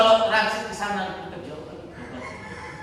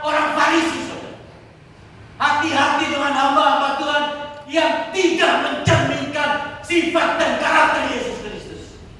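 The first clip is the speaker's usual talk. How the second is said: forceful preaching; 8 semitones higher, 5 dB louder than usual. Real, not acted. Preacher warning the congregation about false servants, forceful and raised. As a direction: hammer the warning out phrase by phrase.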